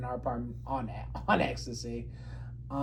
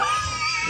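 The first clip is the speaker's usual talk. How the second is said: burst of laughter. Real, not acted. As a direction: crack up at the punchline. Cracks up at the prank reveal.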